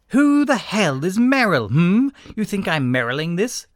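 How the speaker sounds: high, loud, drawn-out confrontation; slow, pointed challenge. A narrator's reading. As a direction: push each word right into their face. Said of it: "then Merrilled slowly and merrily".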